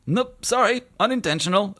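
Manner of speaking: high, loud, cheerful genie. Acted. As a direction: play the genie bright and high. GM voices the genie.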